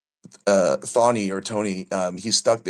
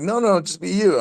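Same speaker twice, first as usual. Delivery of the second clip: way up high, imitating the host brushing it off; high mock insistence; 11 semitones higher than usual. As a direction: squeak it out like a cartoon version of him. Retelling the host's insistence.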